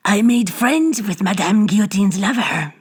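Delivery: put-on slow, coarse old hag voice. A narrator's reading. Old hag taunts Bibot with her trophy, coarse. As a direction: gloat like a ghoulish crone. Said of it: "with a coarse laugh".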